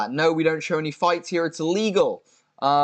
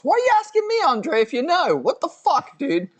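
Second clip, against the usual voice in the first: mock outrage; 13 semitones higher than usual. Mock scolding Andre for asking him. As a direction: yelp it like a cartoon.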